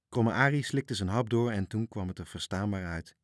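usual speaking voice

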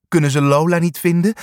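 ordinary delivery

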